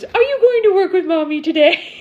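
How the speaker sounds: put-on high, loud sing-song baby talk; husband talking to the baby. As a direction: coo it like a dad talking to the bump. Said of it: "and he goes".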